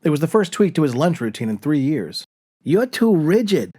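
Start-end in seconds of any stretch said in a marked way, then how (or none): 2.6-3.7 slow, higher Long Island nag, lightly done; mock his wife's Long Island accent; a hint of a nagging Long Island wife. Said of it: "in that awful Long Island accent".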